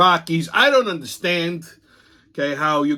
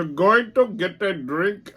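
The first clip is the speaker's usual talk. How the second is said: put-on slow, higher, dopey drunk voice; mock drunk guy; 5 semitones higher, slower than usual. Teller mimics the drunk customer heading for a drink, slow and dopey. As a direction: mimic the drunk lurching toward the bar.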